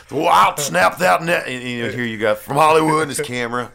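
put-on loud, high, barking Southern accent. Impersonating a wrestler's barking Southern accent on his Hollywood gimmick. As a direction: bark it out like a brash Southern wrestler. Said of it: "this barking Southern".

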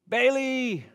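high call across the backyard; calling the dog. Retold or imitated. Owner calling dog in from the cold, high call.

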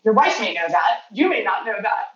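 normal talking voice

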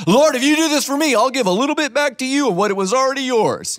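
high, loud wheedling prayer voice; bargaining prayer. Acted. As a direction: plead and bargain like a child. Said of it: "negotiating with someone who owns it".

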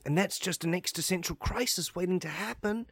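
normal talking voice

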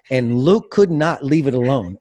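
ordinary delivery

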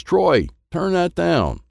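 high, slow mock scold from mom; a mother telling him off; Mommy scolding. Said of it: "Mommy says".

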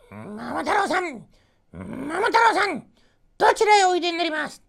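a pheasant calling to Momotaro; high birdlike voice. A narrator's reading. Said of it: "と尋ねました".

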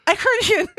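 high, loud burst through laughter; helpless giggly squeal; laughing. Real, not acted. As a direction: crack up while saying the German word. Cracking up over the long word.

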